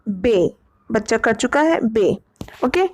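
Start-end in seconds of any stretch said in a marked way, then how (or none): 0.1-0.5 बे said with a plain e sound; teacher models बे slowly; pron demo. Shown as a demonstration. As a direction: clear बे with a short e. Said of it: "इसको बोलते हैं बे".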